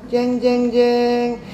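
sung jeng jeng suspense sting; mock suspense music; dramatic sting. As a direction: hum a dramatic drumroll sting. Dramatic twist in the story.

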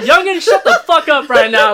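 imitating an elder. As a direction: scold like an elder, laughing. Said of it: "she's like".

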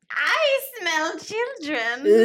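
high, slow, drawn-out witch voice; witchy voice. Acted. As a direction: stretch it out like a cackling witch. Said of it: "In your best witchy voice".

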